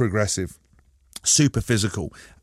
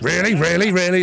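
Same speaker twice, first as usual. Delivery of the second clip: high, louder incredulous repeats; mock eager listeners; 8 semitones higher, 5 dB louder than usual. Listeners hoping for real emails, mock incredulous. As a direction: gush like a gullible fan. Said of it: "people on the radio like".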